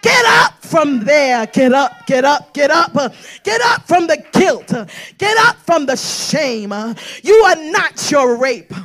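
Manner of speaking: loud, rising sermon cry over music; full force preaching. Acted. Preacher urging the women listening to get up, full force preaching. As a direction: preach it hard, call her to rise.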